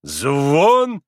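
loud, drawn-out rising question; baffled, stretched query; puzzled. A narrator's reading. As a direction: echo the word, not understanding. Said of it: "не поняв, что такое перезвон".

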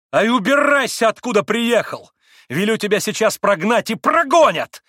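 high, loud angry tirade; heated, high-voiced outburst; indignant shouting. A narrator's reading. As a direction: shout it out, pitched well up. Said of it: "крикнула в выступлении Грушенька".

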